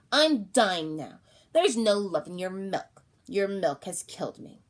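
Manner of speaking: slow and loud, each word weighted; a dying baby's slow, loud accusation. Acted. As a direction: deliver the accusation slowly, like a dying curse. Dying baby accusing mother.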